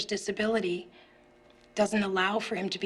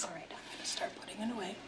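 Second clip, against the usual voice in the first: soft unvoiced whisper; 10 dB quieter, faster than usual. Real, not acted. Teacher whispers during standoff.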